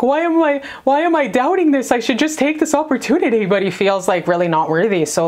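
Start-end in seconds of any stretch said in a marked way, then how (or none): 0.0-3.5 higher-pitched, doubting himself; a hint of an anxious man talking to himself; imitating the nervous guy. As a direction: voice the guy second-guessing himself. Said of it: "feeling like".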